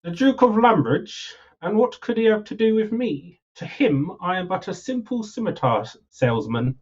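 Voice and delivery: lightly voiced humble merchant, lilting; merchant character. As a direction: play a modest salesman, slightly theatrical.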